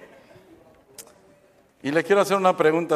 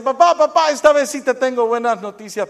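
put-on high, loud, eager child calling his dad; a boy bursting in with news, much higher and louder; excited kid; 10 semitones higher, 8 dB louder than usual. Juanito to his dad, announcing news in a high, loud, excited voice.

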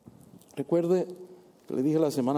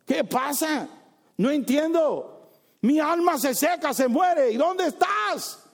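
high, slow anguished questions; David's anguished cry; 11 semitones higher, 4 dB louder, slower than usual. Retold or imitated. Preacher voicing David's lament in the psalms, high and anguished. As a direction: cry out in despair. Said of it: "David está gritando".

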